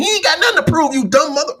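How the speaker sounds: high, heated mock retort; indignant commenter. Viewers in the comments arguing back, indignant. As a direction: snap back like an angry commenter. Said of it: "you all in the comments are saying".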